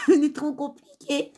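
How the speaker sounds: amused, giggling complaint; laughing. Real, not acted. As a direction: laugh while saying it. Laughing at how complicated French is.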